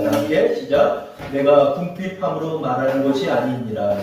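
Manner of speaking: slow unison Bible reading; reading scripture together. Real, not acted. Pastor leading the church in reading Philippians, slow and measured. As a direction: read each phrase together, slowly. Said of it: "우리 한번 합독하고 해보겠습니다".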